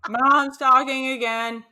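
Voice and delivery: laughing through the words. Real, not acted. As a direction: giggle it out slowly.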